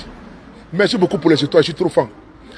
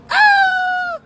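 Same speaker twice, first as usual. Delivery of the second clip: sudden yelp, far higher and shouted; high joyful yelp. Real, not acted. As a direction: let out a burst of joy. Cheering a supporter's gift live. (cri de joie très aigu)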